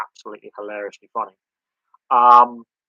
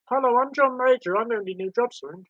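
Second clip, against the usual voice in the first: much higher, prim politician voice; mock the former prime minister's prim tone; John Major impression; 12 semitones higher, 3 dB louder than usual. Streamer showing how a former prime minister talks, high and prim. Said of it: "'Cause John Major talks like this".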